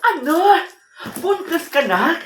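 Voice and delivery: high, loud burst of disbelief; shocked mother. Acted. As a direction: react aghast to a daughter's pregnancy. Mother learns daughter is pregnant.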